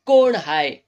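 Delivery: loud call through the door; shouted call. A narrator's reading. Landlord shouting from bed at the knock. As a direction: call out sharply who's there. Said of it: "Jahagirdar ओरडला".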